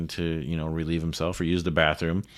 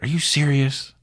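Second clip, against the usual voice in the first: higher, louder incredulous question; mock disbelief; 9 semitones higher, 4 dB louder than usual. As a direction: act amazed at the news. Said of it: "And I'm like".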